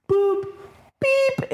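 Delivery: very high electronic beep-boop; keypad beeps. Retold or imitated. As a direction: chirp like a keypad.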